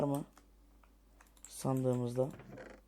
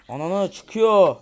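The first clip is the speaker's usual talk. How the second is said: loud, slow, higher alarmed shout; startled yell; 5 semitones higher, 14 dB louder, much slower than usual. Real, not acted. Gamer yelling as a baby zombie appears.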